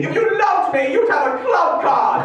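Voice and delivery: screeching. Pretend. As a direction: screech it like a clingy jilted lover. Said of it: "just screeching".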